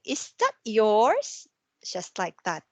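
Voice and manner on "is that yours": slow question rising on that; rising confirm question. Shown as a demonstration. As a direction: lift the voice to confirm. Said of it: "Or to confirm something".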